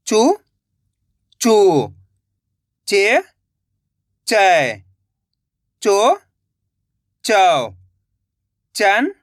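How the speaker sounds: each syllable said alone, slow and clear, with a pause after it; syllable drill. Shown as a demonstration. Teacher drilling students on the barakhadi, clear syllable recitation. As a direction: recite syllables for the class to repeat. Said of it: "जिस तरीके से मैं एक एक अक्षर का उच्चारण कर रहा हूँ".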